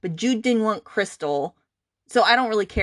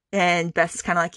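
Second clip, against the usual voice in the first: ordinary delivery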